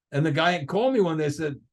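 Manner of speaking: ordinary talk, nothing marked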